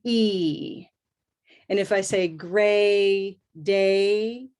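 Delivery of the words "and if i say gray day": slow "gray day" with the vowel moving from A to E; gliding A sound. Shown as a demonstration. Teacher modelling the gray-day vowel, slow. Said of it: "what's that sound".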